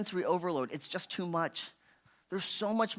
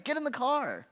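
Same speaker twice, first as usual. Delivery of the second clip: impulsive order; 8 semitones higher than usual. Acted. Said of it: "there's how we wanna react".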